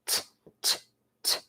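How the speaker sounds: "T, t, t," as soft breathy t-t-t taps; letter sound T. Shown as a demonstration. Teacher sounds out the letter T for the kids, softly. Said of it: "T makes one sound".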